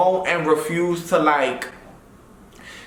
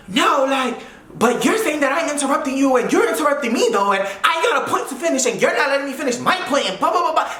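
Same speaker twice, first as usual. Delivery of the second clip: higher, fast, indignant imitation of the friend; mock her ranting over me; mock whiny rant; 7 semitones higher, faster than usual. A friend's rant retold in a higher, sped-up mock voice. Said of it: "it was just her yelling".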